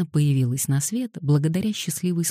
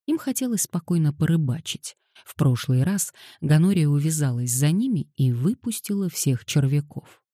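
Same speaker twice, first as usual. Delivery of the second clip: plain reading voice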